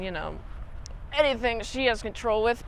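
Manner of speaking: ordinary talk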